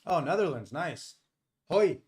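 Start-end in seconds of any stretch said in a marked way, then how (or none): none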